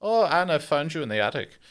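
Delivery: a hint of a gloating Nazi; mock Gestapo officer. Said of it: "A wee Gestapo role play".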